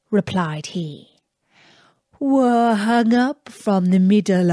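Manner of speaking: lightly voiced young Aladdin, slow and musing; a slow, dreamy hint of Aladdin; Aladdin's voice. Aladdin speaks to the princess.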